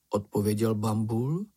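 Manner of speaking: ordinary delivery, nothing marked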